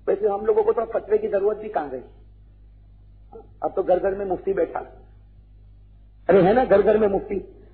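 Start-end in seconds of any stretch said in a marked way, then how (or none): none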